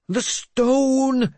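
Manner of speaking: high, loud, stretched cry; calling out. A narrator's reading. Mad bishop calls after his lord for the stone, crying out. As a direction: call it out in a very high voice. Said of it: "Jeremias called again".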